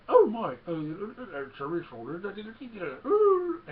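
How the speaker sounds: high, lurching robot babble; mock garbled, swooping droid fretting; malfunctioning droid impression. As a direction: sputter like a droid with scrambled wiring. Imitating scrambled droid being repaired.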